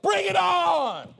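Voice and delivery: high, loud, slow defiant cry; defiant martyr shout. Pretend. Martyrs facing death shouting defiance, high and loud. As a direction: hurl the challenge back at the persecutors. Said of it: "martyrs would be like".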